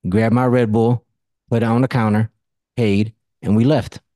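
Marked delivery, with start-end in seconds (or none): none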